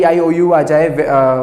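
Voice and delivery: usual speaking voice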